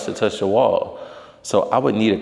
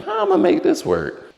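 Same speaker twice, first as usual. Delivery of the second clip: exasperated; 5 dB louder than usual. Real, not acted. Frustrated over couch layout.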